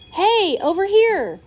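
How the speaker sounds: loud, slow, high call from a rooftop; yelling. A narrator's reading. Man on the roof yelling to the boat, loud slow call. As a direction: shout it across the water. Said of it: "yelled at us".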